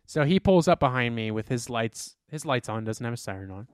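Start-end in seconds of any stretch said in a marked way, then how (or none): none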